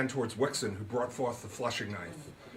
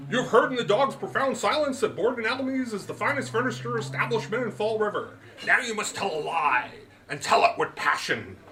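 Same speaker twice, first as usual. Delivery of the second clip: put-on higher, louder, grand old gentleman; Root's voice; 9 semitones higher, 5 dB louder than usual. A narrator's reading. Root lectures his guest on the magic word, higher and grand. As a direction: pronounce it like a showman, slowing on the magic word.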